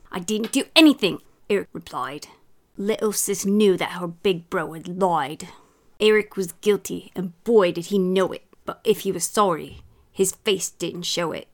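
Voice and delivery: story read in a bad British accent; hammy British accent, lively storytelling; put-on British accent. Mum reading a children's story for laughs, put-on British accent. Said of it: "throw a little accent in there".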